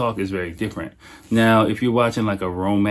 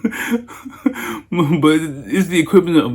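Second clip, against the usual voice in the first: talking through a laugh, higher pitched; 8 semitones higher, slower than usual. Real, not acted. Man laughing at his own blunder at a wedding, amused. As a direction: let the laugh run into the words.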